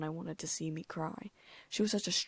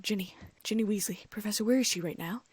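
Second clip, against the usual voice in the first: out of breath; 4 semitones higher, 5 dB louder than usual. Acted. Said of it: "I breathed hard".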